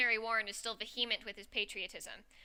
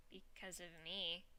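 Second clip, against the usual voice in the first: very soft, slow and lower; quiet echo; 4 semitones lower, 13 dB quieter, slower than usual. Acted. Character echoes friend's confession, hushed and slow. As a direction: say it slowly under the breath. Echoing a confession, eyes narrowed.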